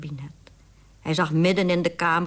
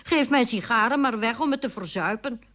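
higher, indignant bitterness; bitter; 6 semitones higher, 4 dB louder than usual. A narrator's reading. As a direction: protest bitterly to yourself. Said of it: "dacht de burgemeester bitter".

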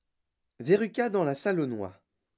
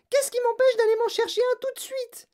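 very high, shrieked challenge; Veruca Salt's yell; 4 dB louder than usual. A narrator's reading. Spoilt girl threatening to fetch one, yelling. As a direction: shriek it defiantly. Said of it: "hurla Veruca Salt".

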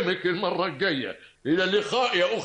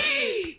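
gasp. Acted. Shock at the news.

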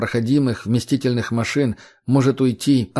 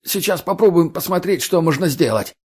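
panicked pleading; 6 semitones higher than usual. A narrator's reading. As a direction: plead for your life. Begging at gunpoint.

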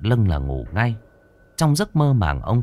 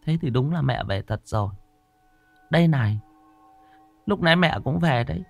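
high-pitched, anxious wife voice; fretful wife; 6 semitones higher, faster than usual. Acted. Wife pestering her trembling husband, high and anxious. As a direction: press him with a high, worried nag. Wife tells of mother's visit.

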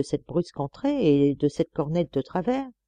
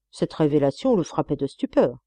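usual speaking voice

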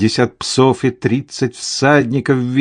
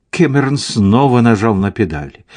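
ordinary delivery

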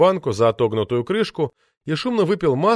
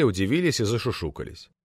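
usual speaking voice

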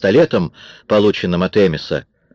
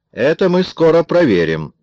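threatening; 10 semitones higher, 3 dB louder than usual. A narrator's reading. Amis promises to check the story, threatening. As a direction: stretch it out as a threat. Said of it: "угрожающе произнёс Эмис".

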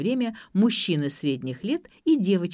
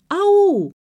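shrieking; 12 semitones higher, 10 dB louder than usual. A narrator's reading. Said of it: "завизжал кто-то".

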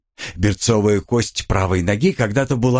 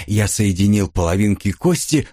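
plain reading voice

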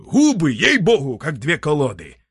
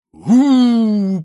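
a big booming ooh as the stone rolls away; loud rumbling whoosh; 10 semitones higher, 10 dB louder than usual. A narrator's reading. Storyteller booms the stone crashing down the valley. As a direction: boom it out like a falling boulder.

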